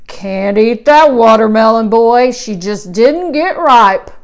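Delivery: low, loud country uncle; gruff old farmer uncle. A narrator's reading. Uncle E speaks in story.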